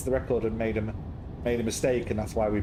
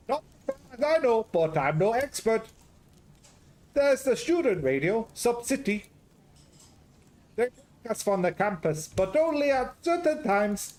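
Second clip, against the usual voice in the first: put-on garbled Greek-Scottish accent; 9 semitones higher than usual. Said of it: "Garbled Greek Scottish".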